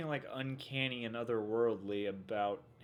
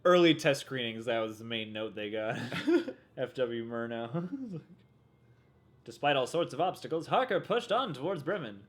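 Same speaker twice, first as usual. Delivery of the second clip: normal talking voice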